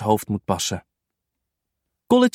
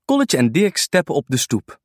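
usual speaking voice

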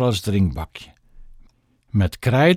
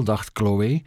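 ordinary delivery

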